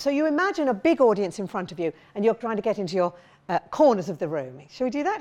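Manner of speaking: usual speaking voice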